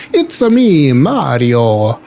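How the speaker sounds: a hammed-up, half-hearted Italian accent; bad Italian accent as Mario. Greeting a big Mario figure in a bad Italian accent. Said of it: "I can't do an Italian accent".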